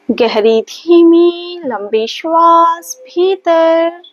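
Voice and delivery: slow, sustained, stretched-out breath cues; measured yoga-teacher breathing count on a held tone; guided breathing. Acted. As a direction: draw each word out to pace a long breath.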